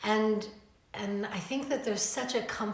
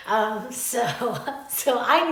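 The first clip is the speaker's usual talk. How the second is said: real laughter breaking into the words; higher, louder, laughing through it; 4 semitones higher, 9 dB louder, slower than usual. Real, not acted. Laughs at own breathless excitement.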